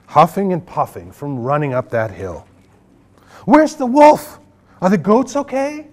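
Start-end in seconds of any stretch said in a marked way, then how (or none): 3.5-5.9 high, louder panicked call; alarmed cry. Acted. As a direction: shout in alarm. Villagers arrive panicked.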